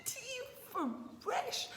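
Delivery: high, slow, mock indignant complaint. Scolding her partner over fresh tea, in a high, slow mock complaint. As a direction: stretch out the outrage, climbing up high. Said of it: "I said".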